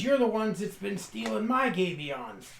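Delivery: a touch of rustic indignation; accusing farmer. Acted. Acted accusation by [name].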